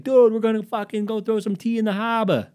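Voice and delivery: very high, excited yell; rowdy high-pitched bro; hyped-up Boston friend. Acted. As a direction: holler a wild plan at your buddy. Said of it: "And he would just be like".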